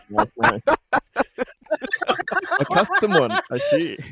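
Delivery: laughing. Real, not acted. Players laughing at custom talent.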